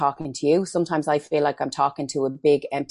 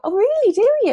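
much higher and louder, hammed-up delighted surprise; mock surprise; 7 dB louder, faster than usual. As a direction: squeal back in pretend astonishment. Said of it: "And I go".

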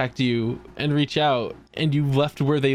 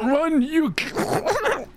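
high, very drawn-out warning; doomed grandpa; 11 semitones higher, much slower than usual. Acted. Grandpa warns Yugi to run, high hammed-up death cry. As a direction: strain out a mock dying cry.

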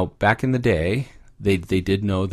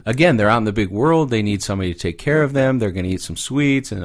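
normal talking voice

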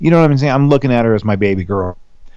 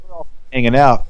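usual speaking voice

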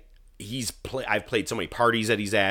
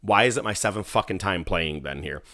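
ordinary talk, nothing marked

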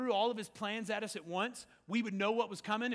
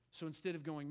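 normal talking voice

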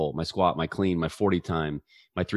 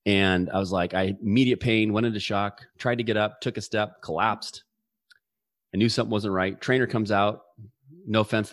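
normal talking voice

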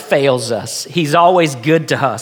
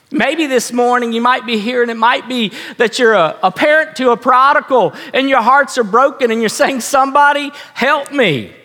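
raised, high-pitched sermon voice; insistent pulpit plea, pitched up; impassioned preaching; 7 semitones higher than usual. Acted. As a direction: preach urgently to the hurting congregation. Preaches with rising fervour.

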